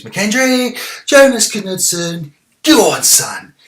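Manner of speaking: high, loud urging the players on; excited cheering. Real, not acted. Gamer cheering his team toward goal, excited. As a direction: yell at the screen as the attack builds. Goal chance in football game.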